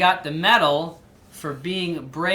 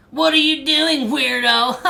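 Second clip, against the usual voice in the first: high, loud mocking jeer; taunting bully; 8 semitones higher, 7 dB louder than usual. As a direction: jeer at the weird girl. Twins mocking Violet.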